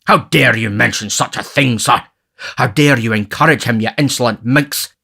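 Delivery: pompous scandalised rebuke; indignant beadle's tirade; strong indignation. A narrator's reading. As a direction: scold in outrage, bursting out at the end. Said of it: "exclaimed Mister Bumble in a strong indignation".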